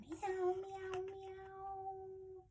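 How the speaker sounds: high, soft mewing, fading away; prissy cat meow. Acted. Reader shows children a cat's hello, high soft meows. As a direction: mew daintily high and quiet. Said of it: "How does a cat say hello?".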